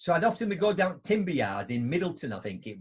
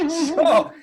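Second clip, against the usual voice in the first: hearty laugh; 13 semitones higher, 10 dB louder than usual. Real, not acted. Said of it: "laughs at own story".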